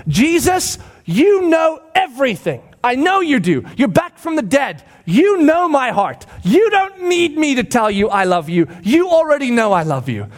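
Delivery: high, pleading exasperation; grieved Peter. Acted. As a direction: plead loudly to be believed. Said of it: "Peter was grieved".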